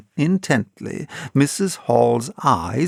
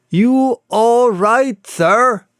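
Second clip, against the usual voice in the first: loud slow call through a door; 9 semitones higher, 4 dB louder, much slower than usual. Acted. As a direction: call out to the room. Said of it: "asked Hall, rapping".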